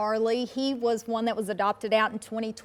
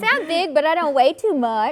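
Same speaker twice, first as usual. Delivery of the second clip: higher, louder playful dog's voice; voicing the dog; 5 semitones higher, 7 dB louder than usual. Rescuer answering for the big collie, playful dog voice. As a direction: speak up playfully on the dog's behalf.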